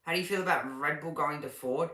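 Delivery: ordinary talk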